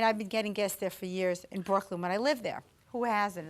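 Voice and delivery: ordinary talk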